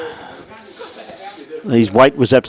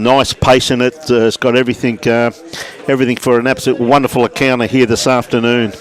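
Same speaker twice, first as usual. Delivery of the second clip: usual speaking voice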